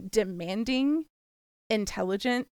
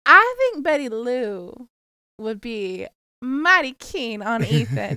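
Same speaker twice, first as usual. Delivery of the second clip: slow, louder Southern drawl; Southern accent; 4 dB louder, slower than usual. Pretend. Host offering her friend Betty Lou to Ethan, Southern drawl. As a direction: play a sweet Southern matchmaker, slowly.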